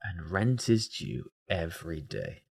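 low, soft and slow; muttered to himself. Real, not acted. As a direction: mutter it under your breath.

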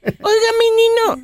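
put-on high-pitched little boy voice; high, childish call to godfather; little godson. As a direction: play a small child addressing his godfather. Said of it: "y le dice".

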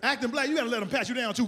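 ordinary delivery

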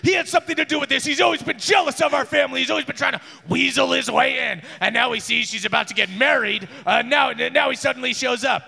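rant, tripping over the words; frantic accusation. Acted. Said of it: "He's like".

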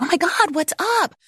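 high, worried "what's up"; sudden high-pitched worry; alarmed concern. Retold or imitated. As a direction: rush to a crying child, startled. Mom finds son crying silently.

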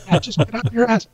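quoted through a high laugh; giggling mock order; laughing quote. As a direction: laugh while mimicking the line. Laughing while quoting the dad.

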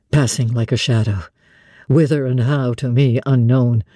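low and pleading, dreading she will vanish; deep, sorrowful questioning; Rochester, fearful lament. Acted. Narrator voices Rochester.

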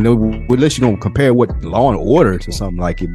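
ordinary talk

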